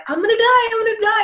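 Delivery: high, loud retold panicked cry; hammed-up fear of falling; mock panic. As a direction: comically wail in terror.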